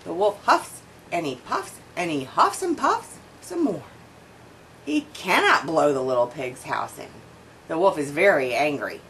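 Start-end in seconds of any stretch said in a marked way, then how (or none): none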